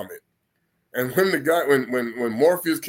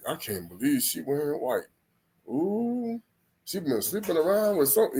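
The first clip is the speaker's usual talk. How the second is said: softer, catty wedding gossip; gossiping guest; 6 dB quieter than usual. Retold or imitated. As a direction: dish the dirt at the wedding. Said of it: "You know how they go?".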